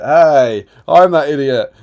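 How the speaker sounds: loud, laughing mock indignation; mock outrage. Host finds he is the idiot, loud mock outrage. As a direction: play up being insulted, loudly. Host realises the insult is him.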